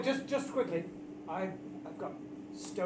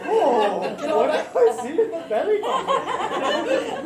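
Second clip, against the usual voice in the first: laughing; 9 semitones higher, 9 dB louder, much slower than usual. Real, not acted. Laughing question.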